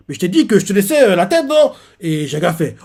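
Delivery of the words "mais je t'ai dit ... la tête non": fast, rising to a high innocent whine; smug rival feigning innocence; mock innocence. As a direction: play dumb and needle him.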